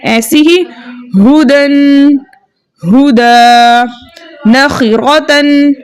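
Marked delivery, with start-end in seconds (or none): 1.2-5.7 slow level chant, one word at a time; tanween and pause drill. Shown as a demonstration. Teacher drills pupils on joined and stopped forms, slow chanted words. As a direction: chant each word, humming n then holding long a.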